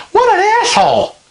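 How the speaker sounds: high, far-off mock voice of disbelief; muttered disbelief. Husband reacting to rude officer, high mock disbelief. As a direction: squeak it out like you can't believe him. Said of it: "Kevin's kinda standing there like".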